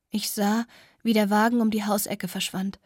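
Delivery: ordinary delivery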